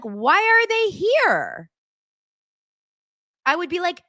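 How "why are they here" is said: high, slow fake puzzlement; sarcastic why are they here; mock puzzled reaction. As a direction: play the wrong reaction mockingly. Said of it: "my first thought wouldn't be like".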